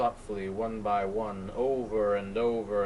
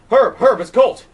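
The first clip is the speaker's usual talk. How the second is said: much higher, much louder cries; frantic shout; 13 semitones higher, 17 dB louder than usual. Acted. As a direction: shout to be heard down the line. Colt shouting into phone.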